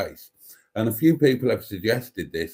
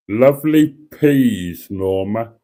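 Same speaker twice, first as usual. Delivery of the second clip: John Major; 8 dB louder, much slower than usual. Acted. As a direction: drawl it out like a prim politician. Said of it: "This is my John Major impression".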